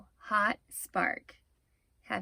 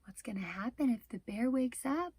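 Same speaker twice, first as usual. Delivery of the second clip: hushed suspenseful whisper; 6 dB quieter, faster than usual. Real, not acted. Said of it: "whispered suspense question".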